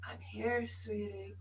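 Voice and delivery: soft, high, gentle; soft loving comfort from Norma; tender reassurance. Retold or imitated. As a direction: soothe her gently. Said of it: "Norma would say".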